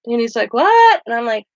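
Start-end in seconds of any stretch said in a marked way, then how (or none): 0.5-1.0 a high, loud startled what; shocked what. Retold or imitated. Star stunned that she refuses his autograph, high and loud. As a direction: bark out your disbelief.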